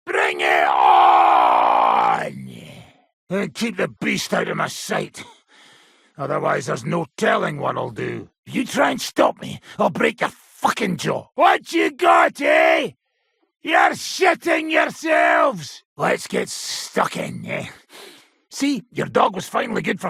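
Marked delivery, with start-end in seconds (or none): 0.1-2.5 loud, slow roaring challenge; battle cry. Acted. 11.4-15.8 loud mocking yell, higher and slow; taunting shout. Taunting brawl opponents.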